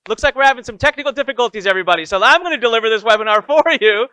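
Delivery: hammed-up cheerful webinar host covering for a glitch; cheery take-over. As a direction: jump in loud and upbeat to rescue the show. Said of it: "And I said".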